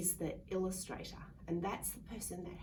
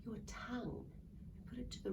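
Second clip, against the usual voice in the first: whisper; 3 semitones higher, 5 dB quieter than usual. Shown as a demonstration. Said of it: "the noise that a gecko makes".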